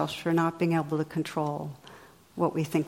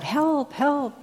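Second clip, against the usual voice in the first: high-pitched cry for help with a falling tail; mock yell for help, pitched way up; 8 semitones higher than usual. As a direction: call out for rescue without full volume. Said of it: "yells out".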